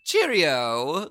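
exaggerated put-on British accent. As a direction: chirp it in a bad British accent. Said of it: "so many letters about this accent".

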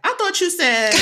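put-on high, loud teasing child voice; kid's gotcha. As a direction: play the kid catching mom out in her own words. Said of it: "Kaya will sometimes be like".

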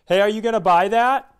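high, loud intruding question; pushy stranger. Retold or imitated. Woman to shopper holding a mug, high loud pushy question. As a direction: call out sharply from behind, rising at the end. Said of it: "I hear a voice behind me go".

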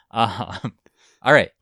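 ordinary talk, nothing marked

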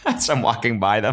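ordinary delivery

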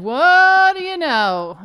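high, loud and slow, gloating; loud drawn-out gloat; triumphant. Real, not acted. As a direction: savor being proven right. Gloating about being right.

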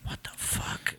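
whispered disbelief, barely any voice; imitating a stunned, very soft whisper. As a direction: breathe it out in hushed shock. Retold stunned reaction to a story.

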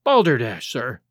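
mild angry scoff at the nonsense; a touch of contempt, snorted out; angry exclamation. A narrator's reading. Doctor Tosswell scoffing angrily to Hastings about the impostor. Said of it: "he exclaimed angrily".

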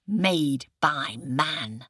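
slow, deliberate tiger voice, barely patient; a big cat spelling it out through its teeth; angry tiger. Acted.

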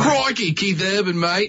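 put-on a quick jokey Australian accent; mock Australian accent. Co-host teasing Chris with a mock Australian accent. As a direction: toss off a hammed-up Aussie "mate". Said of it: "Is that your Australian accent?".

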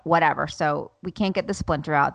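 usual speaking voice

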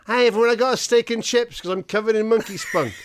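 high, loud hammed-up showing off; mock boastful Joe. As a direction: crow it out like a proud idiot. Mocking Joe bragging about steak.

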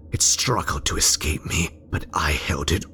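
usual speaking voice

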